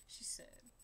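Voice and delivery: a breathy whisper slipped between lines; whispered aside. Pretend.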